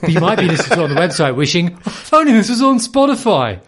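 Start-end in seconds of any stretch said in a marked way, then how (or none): 1.9-3.6 infomercial. Acted. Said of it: "you said that like you're in an infomercial".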